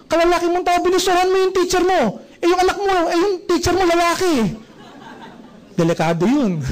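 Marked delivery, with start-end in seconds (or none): 0.1-4.6 higher-pitched shocked scolding; scandalised parent. Retold or imitated. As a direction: scold the son in mock horror.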